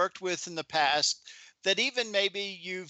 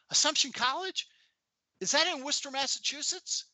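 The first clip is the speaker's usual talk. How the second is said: imitating a Boston accent, pitched much higher; a woman's Boston accent, voiced high and curious; 11 semitones higher than usual. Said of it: "she had a Boston accent".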